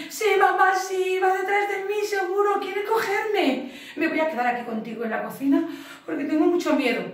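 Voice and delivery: put-on high, frightened little-boy voice; scared kitten. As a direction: squeal it like a scared child cat. Child cat voice, scared.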